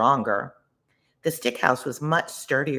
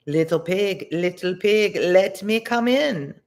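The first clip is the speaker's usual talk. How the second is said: rising, louder coaxing call, slow at the end; big bad wolf; 5 semitones higher, 4 dB louder, slower than usual. A narrator's reading. Wolf calls to the brick house pig, coaxing. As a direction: coax the pig to open up. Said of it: "the wolf said".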